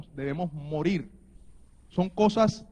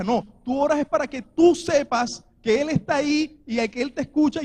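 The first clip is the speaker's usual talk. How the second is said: louder, higher preaching at full force; fiery preaching; 4 semitones higher, 5 dB louder than usual. Acted. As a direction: drive the point home to the congregation.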